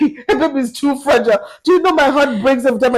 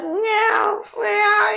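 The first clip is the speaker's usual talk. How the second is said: imitated baby wail; 9 semitones higher, 4 dB quieter than usual. Mother imitates a baby crying on the bus, high mock wail. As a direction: wail it like a fussing baby in a buggy. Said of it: "There's a cry like".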